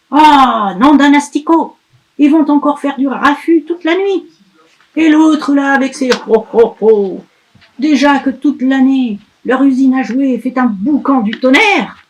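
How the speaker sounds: loud, high, furious witch tirade; grumpy witch ranting. Acted. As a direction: spit out every complaint like a cranky old witch. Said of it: "Pustula est très énervée".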